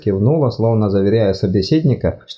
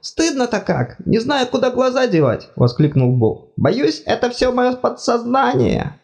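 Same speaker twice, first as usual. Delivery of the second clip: high fussy god voice, apologetic; flustered deity, much higher pitched; embarrassed god. Acted. God ashamed.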